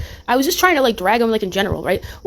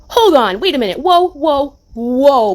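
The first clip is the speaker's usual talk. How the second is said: startled whoa; 2 semitones higher, 3 dB louder, slower than usual. Real, not acted. Stops on hearing Cooch's name.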